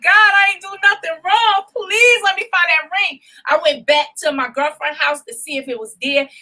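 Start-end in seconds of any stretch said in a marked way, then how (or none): 0.0-3.2 high, pleading, close to tears; tearful plea to God. Retold or imitated. Pleading with God over the lost ring, tearful. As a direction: beg God with a breaking voice. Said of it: "I was like".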